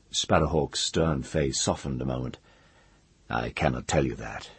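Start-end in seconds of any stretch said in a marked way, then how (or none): 3.3-4.5 low, gentle refusal. A narrator's reading. Mage refusing the woman his name, gently. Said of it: "he said gently".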